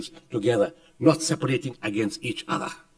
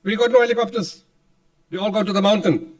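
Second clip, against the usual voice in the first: Pakistani general's voice; 5 semitones higher, 5 dB louder than usual. Retold or imitated. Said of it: "He said".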